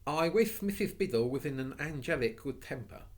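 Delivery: sudden cry. Acted. Anthea suddenly crying out her wish, hopeful. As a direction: blurt the wish out quickly. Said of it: "cried Anthea suddenly".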